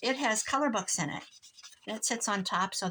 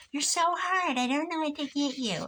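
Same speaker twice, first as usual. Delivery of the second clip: mock daughter whine; 8 semitones higher than usual. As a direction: play the exasperated daughter, pitched up. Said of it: "my daughter said".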